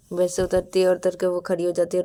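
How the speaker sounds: ordinary delivery, nothing marked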